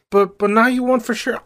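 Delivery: put-on high, pleading boy-king voice; whiny Ptolemy. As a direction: plead like a clueless preteen.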